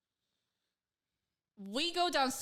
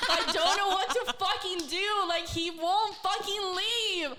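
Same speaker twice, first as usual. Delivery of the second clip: desperate; 9 semitones higher, 3 dB louder than usual. Retold or imitated. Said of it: "I am desperate now".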